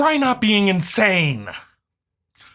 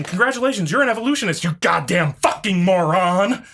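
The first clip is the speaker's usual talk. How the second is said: ordinary delivery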